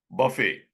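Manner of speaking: buffet said ba-fay, no T; American buffet. Shown as a demonstration. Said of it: "In America, it is pronounced as".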